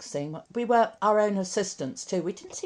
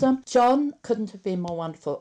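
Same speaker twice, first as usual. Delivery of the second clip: ordinary talk, nothing marked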